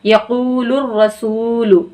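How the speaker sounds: यकूलुर रसूलु very slow, the long vowels stretched; slow Quranic recitation. Shown as a demonstration. Teacher slowly recites यकूलुर रसूलु for students. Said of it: "यहाँ भी हम खींचेंगे".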